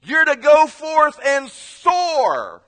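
high, shouted, drawn-out commands; booming mock-sermon finale; duck preacher's climax. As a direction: cry it out as a rousing altar call. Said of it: "he would end his message and say".

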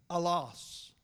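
"Allos" said as AH-los, stress on the first syllable; Greek pronunciation. Shown as a demonstration. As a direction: pronounce "Allos" carefully for the class. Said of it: "is a Greek word that's pronounced".

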